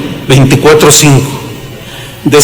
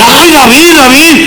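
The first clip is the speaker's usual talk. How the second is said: startled reply; 8 semitones higher than usual. Retold or imitated. Acts out Saul waking and answering.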